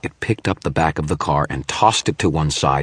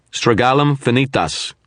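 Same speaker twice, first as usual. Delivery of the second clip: loud, high incantation; spell incantation; 9 semitones higher, 4 dB louder than usual. A narrator's reading. Wizard casting his counterspell at the image, loud incantation. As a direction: hurl the spell words.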